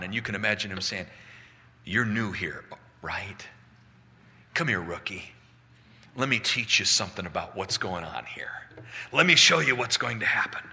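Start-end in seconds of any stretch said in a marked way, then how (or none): 1.8-10.6 put-on low, knowing old-timer to a newcomer; comforting elder. Elder comforting John as a newcomer, low and reassuring. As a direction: take the rookie aside and reassure him, low. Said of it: "an elder comes in to comfort John".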